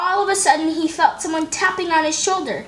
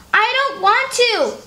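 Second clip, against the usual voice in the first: put-on stubborn little girl; 8 semitones higher than usual. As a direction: whine it out like a reluctant kid. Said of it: "Beatrice repeated".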